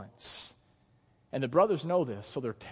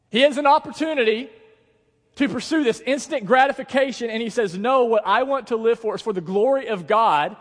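high, loud, driving sermon voice; full-force preaching; 9 semitones higher, 9 dB louder than usual. Real, not acted. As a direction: preach it to the back row.

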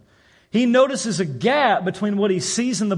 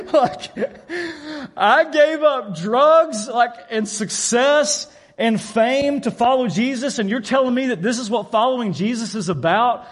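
exasperated outburst; 4 semitones higher than usual. Retold or imitated. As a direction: let the frustration burst out with a laugh. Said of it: "very frustrated and very disappointed".

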